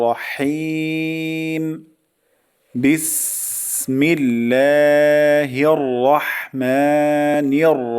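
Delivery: very slow, melodic, drawn-out recitation; Quranic recitation. Shown as a demonstration. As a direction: chant it with full tajweed. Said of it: "আরো দুইবার উচ্চারণ করছি". (সুর করে তেলাওয়াত)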